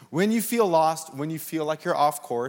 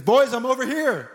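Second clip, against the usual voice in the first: calling out; 6 semitones higher, 4 dB louder than usual. Retold or imitated. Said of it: "he says".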